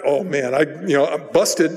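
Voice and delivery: ordinary talk, nothing marked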